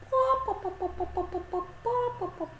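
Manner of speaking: put-on high, quick-fire clucks with accented peaks; playful high hen imitation; hen clucking.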